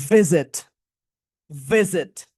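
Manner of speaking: "ভিজিট" with a long vibrating v; buzzed V demo. Shown as a demonstration. Teacher gives the next V word, buzzing the v. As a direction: model "ভিজিট", leaning on the opening v. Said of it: "okay, next".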